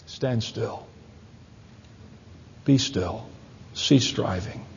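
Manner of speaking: soft, low, hushed voice of God; gentle whisper. Acted. Pastor voicing God to Elijah in the cave, gentle hush. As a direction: speak it softly like a still small voice. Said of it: "God spoke to him in the gentle whisper".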